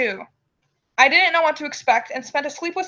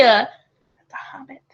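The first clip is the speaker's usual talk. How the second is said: whisper; much slower than usual. Real, not acted. Whispered aside.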